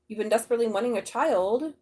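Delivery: normal talking voice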